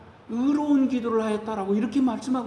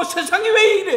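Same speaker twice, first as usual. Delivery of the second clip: mock grumble, higher and louder; complaining; 7 semitones higher, 5 dB louder than usual. Pastor voices resentful complainer, mild exasperated grumble. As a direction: throw up your hands at the world. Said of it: "원망하고 불평하고".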